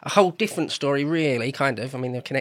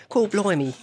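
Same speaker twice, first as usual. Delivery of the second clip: exaggerated Cockney accent, pitched up high; bad Cockney accent; 7 semitones higher than usual.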